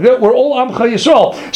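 usual speaking voice

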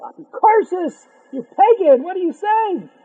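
loud, high mock outcry; outraged protest. Townspeople rebuking the ugly man, outraged protest. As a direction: imitate townsfolk crying out in indignation.

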